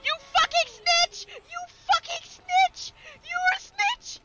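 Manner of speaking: furious yelling. Real, not acted. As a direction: shout it high and loud, outraged. Game betrayal, yelling at character.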